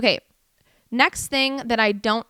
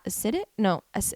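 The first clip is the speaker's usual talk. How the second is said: usual speaking voice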